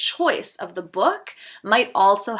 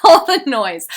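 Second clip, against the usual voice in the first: laughing; 4 semitones higher, 13 dB louder than usual. Real, not acted. Laughing after the mock cry.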